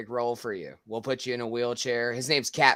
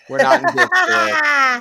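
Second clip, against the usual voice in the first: much higher, louder put-on voice; mock announcer; 14 semitones higher, 10 dB louder than usual. Joking with friends on a call, high mock voice. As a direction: squeak it out like a parody.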